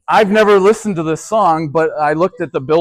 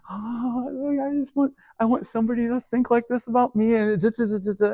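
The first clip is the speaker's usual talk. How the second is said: higher, softer swooning voice; mock dreamy longing; 7 semitones higher, 6 dB quieter than usual. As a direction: sigh it like a lovesick teen. Mocking a love-struck listener.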